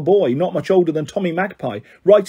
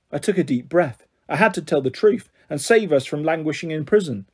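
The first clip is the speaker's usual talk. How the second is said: plain reading voice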